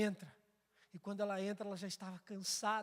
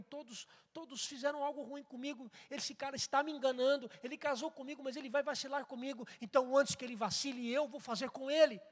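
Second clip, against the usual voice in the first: starts quick and rises higher and louder into a furious rant; bitter suspicion building to anger; 6 semitones higher, 6 dB louder than usual. Acted. Voicing the wife who trusts no man, building from bitter to shouting. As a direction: let the grudge swell until she snaps.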